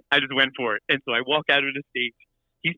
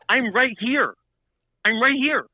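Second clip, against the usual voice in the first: higher-pitched, affronted protest; indignant insistence; 6 semitones higher, slower than usual. Retold or imitated. Stage manager confronting the star who ignores him, indignant insistence. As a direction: insist on being acknowledged. Retold confrontation with star's handlers.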